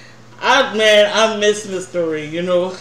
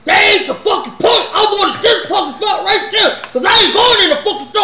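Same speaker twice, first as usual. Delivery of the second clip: much higher and louder angry shout; mock yell; 12 semitones higher, 6 dB louder than usual. Teller imitates Ray yelling he wants this spot, high loud shout. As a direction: shout it, refusing to budge.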